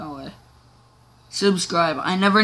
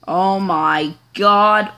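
very slow, louder oh my God; 6 dB louder, much slower than usual. Real, not acted. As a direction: drag it out in awe. Drawn out.

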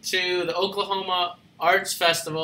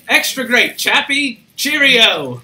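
higher, louder hammed-up British accent; 4 semitones higher, 6 dB louder, slower than usual. Host answering how he is, hammed-up British accent. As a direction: ham up a jolly British accent. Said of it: "I can do a British accent".

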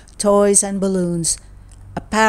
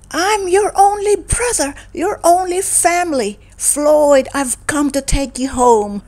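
insistent plea; 8 semitones higher than usual. Acted. Angry brother pleads.

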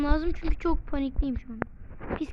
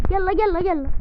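taunting call; 4 semitones higher, 6 dB louder than usual. Real, not acted. Taunting enemy to come.

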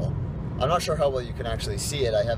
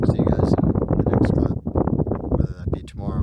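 whispered sign-off; 7 semitones lower, 5 dB louder than usual. Real, not acted. Late night vlog sign-off.

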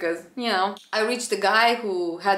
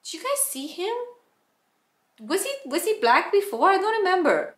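higher, quick gossipy questions; the cabin manager asking the crew, higher and quick; curious cabin manager; 9 semitones higher, faster than usual. Retold or imitated. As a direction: play the nosy cabin manager. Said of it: "the cabin manager".

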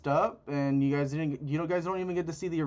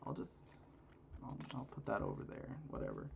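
ordinary talk

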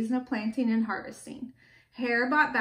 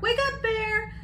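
high, singsong wake-up call; storybook hare calling, pitched high; 10 semitones higher than usual. A narrator's reading. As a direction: call out to rouse a sleeper. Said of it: "Hare called out".

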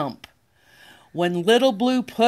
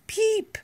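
peep; 13 semitones higher, 4 dB quieter than usual. Acted. Chick sound in a picture book.

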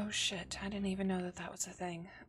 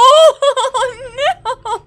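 shrieking, very high, loud laugh close to crying; real hysterical laugh-scream; panicked laugh-cry; 25 dB louder than usual. Real, not acted. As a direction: laugh from pure terror. Panicking at horror game.

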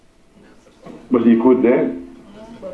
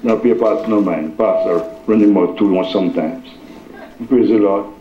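ordinary talk, nothing marked

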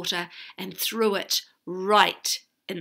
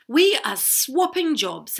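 louder, higher royal announcement; announcing princess; 6 semitones higher, 7 dB louder than usual. A narrator's reading. Princess telling Tom of the job swap, announcing. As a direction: declare it loudly like a princess in charge. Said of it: "announced Princess Arabella".

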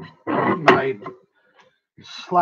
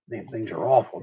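normal talking voice

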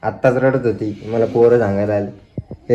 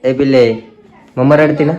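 playful coaxing; 6 semitones higher, 4 dB louder than usual. Real, not acted. Teasing the toddler about mummy crying, playful high coaxing. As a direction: tease the little one into agreeing.

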